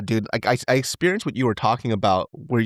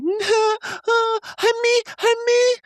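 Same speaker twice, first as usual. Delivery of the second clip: put-on much higher baby voice calling out; toddler voice; slower than usual. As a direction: imitate a little boy reaching for grandma. Imitating toddler calling grandma.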